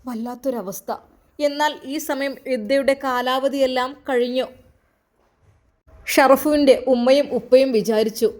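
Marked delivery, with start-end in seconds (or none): none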